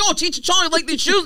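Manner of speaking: a hint of a chirpy interviewer; mock interviewer. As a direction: play the eager interviewer asking. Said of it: "They're like".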